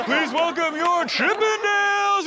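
high, hammed-up MC announcement; mock emcee introducing the dancers; announcer voice. Comedian imitating the show's emcee, hammed-up announcer. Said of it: "the dude was like".

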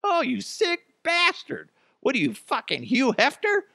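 very high disgusted yell; outraged spouse. Retold or imitated. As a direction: recoil in mock disgust. Acted outraged reply.

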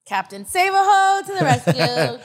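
high, loud, drawn-out hero fanfare; big mock rescue call; mock superhero announcer. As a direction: tease with a slow superhero battle cry. Teasing him with a superhero cry.

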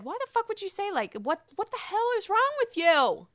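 mock outraged Andy Cohen.

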